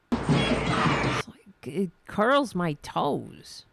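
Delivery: normal talking voice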